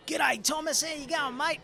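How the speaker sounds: broad, high, exaggerated Australian twang; big hearty Australian character voice; heavy Aussie accent. Shown as a demonstration. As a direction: greet him like a cheery outback mate, Australian through and through. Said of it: "it's a very heavy, like".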